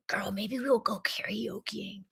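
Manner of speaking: voiceless stage whisper; excited whisper. Pretend. Host to friend about karaoke, conspiratorial whisper. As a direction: whisper it like a secret plan.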